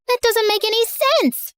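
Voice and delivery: put-on high, sharp "That doesn't make any sense" from a little girl; a seven-year-old calling out a liar, voice pitched high; indignant kid voice. Kid arguing with fake prince.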